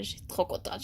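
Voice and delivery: soft unvoiced happy whisper; whispered joy. Real, not acted. Vlogger confiding her happiness, soft whisper. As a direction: breathe it out softly.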